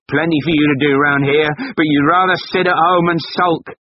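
high, loud contemptuous scold. Acted. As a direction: belittle her loudly. Husband scolding.

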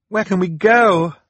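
high, loud panicked cry; cried. Acted. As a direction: call out in alarm over the crashing. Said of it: "Violet cried".

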